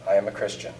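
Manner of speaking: a soft prayer said alone in the woods; hushed prayer. Retold or imitated.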